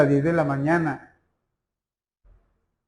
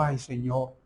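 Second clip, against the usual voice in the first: mild despairing prayer; 7 dB quieter than usual. Retold or imitated. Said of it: "el pastor se colgó y dijo".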